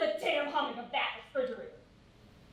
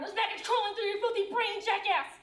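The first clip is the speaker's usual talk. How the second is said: higher-pitched insult blurted mid-joke; sudden tic outburst; 7 semitones higher than usual. Acted. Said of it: "tics just come out of nowhere".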